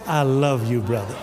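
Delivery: low, settling acted tenderness; calm forgiving reply. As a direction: drop low and answer an insult gently. Models calm answer to mean things.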